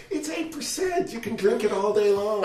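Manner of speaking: high-pitched imitation of a wine expert; wine somm. Wine somm waving off eight percent, high mock-breezy imitation. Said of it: "she'll say things like".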